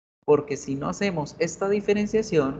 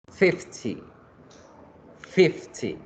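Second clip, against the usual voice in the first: "Fifty" with the first syllable high and strong; stress on FIF; 3 semitones higher, much slower than usual. Shown as a demonstration. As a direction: hit FIF in FIFTY, let -ty drop. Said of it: "la fuerza se hace en la primera sílaba". ("Fifty" con fuerza en la primera sílaba)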